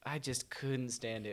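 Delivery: usual speaking voice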